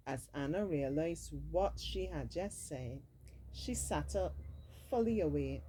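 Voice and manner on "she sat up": usual speaking voice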